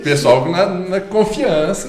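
loud. Shown as a demonstration. Mimicking conducting the orchestra.

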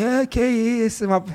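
slow, lazy drawl. Shown as a demonstration. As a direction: drag every word out like you can't be bothered. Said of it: "eu sou, ah, preguiçoso e tal".

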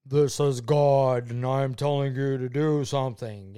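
booming God voice. Retold or imitated. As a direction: announce it like a voice from heaven. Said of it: "It wasn't like".

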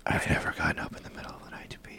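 very soft, voiceless whisper; conspiratorial whisper. Pretend. Friends gossiping while he pees, whispering. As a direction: whisper it behind his back. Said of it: "Let's just whisper about him".

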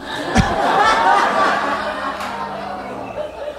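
high breathless giggle; high laugh. Real, not acted. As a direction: crack up at your own joke. Laughs at own punchline.

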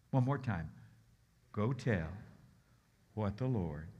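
ordinary delivery

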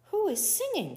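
high-pitched query; puzzled question. A narrator's reading. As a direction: ask it high, searching for the singer. Puzzled Coyote asks around.

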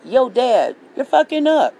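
imitating the kids telling dad to ease up; mock family plea. Kids telling their dad to ease up, mock plea. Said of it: "they would say".

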